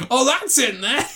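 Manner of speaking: high-pitched startled realisation, played for laughs; mock surprise. Retelling Andy Samberg's scene to co-host, mock surprise.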